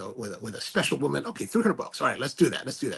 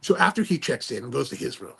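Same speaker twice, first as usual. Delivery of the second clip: usual speaking voice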